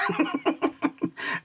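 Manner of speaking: giggling at his own dig; a squeaky, airy burst of laughter; high breathy laugh. Real, not acted.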